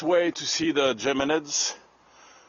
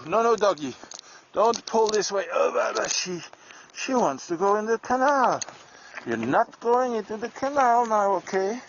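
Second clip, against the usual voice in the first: higher, coaxing pet voice; talking to the dog; 8 semitones higher than usual. Real, not acted. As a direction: gently scold the dog pulling to the canal.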